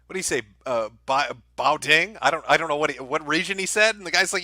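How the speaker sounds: ordinary delivery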